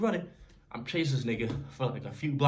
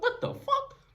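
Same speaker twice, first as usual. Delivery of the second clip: high, stunned what the fuck; stunned disbelief; slower than usual. Retold or imitated. Kid robbed of his money, high stunned outburst. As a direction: blurt it out in total shock. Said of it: "I'm like".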